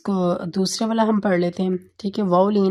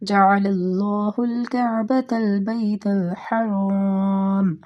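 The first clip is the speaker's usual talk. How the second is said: slow, drawn-out tajweed recitation; Quran recitation; much slower than usual. Shown as a demonstration. Teacher reciting a Quran verse to students, slow chanted recitation. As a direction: recite the verse slowly as in prayer. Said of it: "سورۃ المائدہ کی ninety seven آیت".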